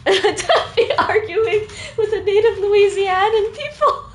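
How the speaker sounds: high, laughing through the words; real giggling protest; laughing scold. Real, not acted. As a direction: scold chat while cracking up. Laughing while scolding chat.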